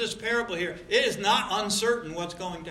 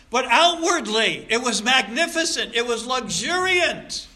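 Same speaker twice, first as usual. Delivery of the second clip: preaching at full force; 6 semitones higher, 8 dB louder than usual. Acted. Preacher denouncing a showy culture without Christ. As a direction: thunder it from the pulpit.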